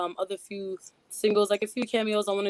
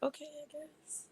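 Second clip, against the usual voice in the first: mock shy thanks; 5 semitones higher, 11 dB quieter than usual. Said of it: "it's like".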